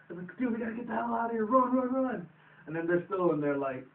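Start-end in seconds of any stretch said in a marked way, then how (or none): 0.4-2.3 high, urgent getaway cry; mock panic. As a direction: act out a panicked escape, pitched up. Acting out a panicked escape.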